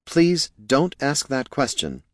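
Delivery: high, slow, deliberate plea; emphatic plea. A narrator's reading. Helper warning friend off a question, slow high plea. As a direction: spell out the warning slowly.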